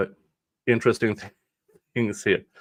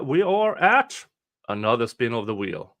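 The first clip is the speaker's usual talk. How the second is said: usual speaking voice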